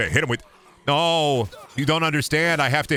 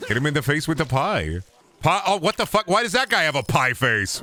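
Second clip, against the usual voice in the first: usual speaking voice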